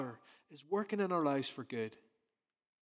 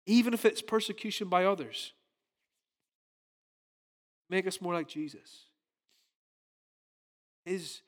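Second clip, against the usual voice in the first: ordinary talk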